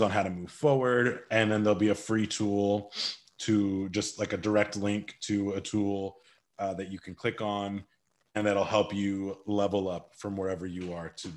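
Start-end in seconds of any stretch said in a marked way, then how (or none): none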